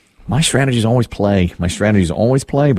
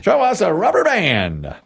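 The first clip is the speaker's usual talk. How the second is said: high, slowed announcer call; drawn-out host's reveal; game-show reveal call; 7 semitones higher than usual. Real, not acted. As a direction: call to the board with suspense. Host calls for answer reveal.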